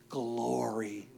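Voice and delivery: very soft, low worship; quiet, low, awed response; hushed reverence. Acted. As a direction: let the word fall to a reverent hush. Worshipers' one word, voiced again.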